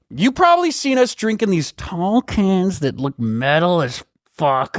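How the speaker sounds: exaggerated pitchman, stretching the vowels; hammed-up ad read. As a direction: stop everyone for a mock-dramatic sponsor pitch.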